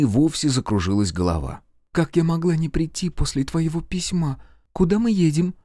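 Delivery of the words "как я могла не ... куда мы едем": high, ardent heroine's voice; enamoured young heroine. A narrator's reading. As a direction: voice her much higher, eager and in love. Narrator voices the heroine answering her beloved. (высокий голос влюблённой героини)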